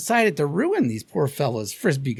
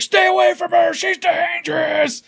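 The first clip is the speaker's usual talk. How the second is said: mimicked panicked shout, very high and loud; freaked-out yell; 13 semitones higher, 5 dB louder than usual. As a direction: yell a warning like you're freaking out, dragging the end. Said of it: "these two guys start freaking out".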